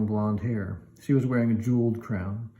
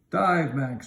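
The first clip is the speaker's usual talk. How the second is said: a firm order, a touch higher; commanding; 5 semitones higher than usual. A narrator's reading. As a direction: give the order like a wizard in charge. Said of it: "Galen commanded".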